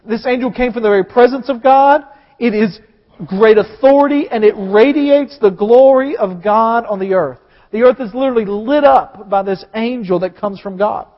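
ordinary talk

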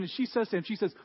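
normal talking voice

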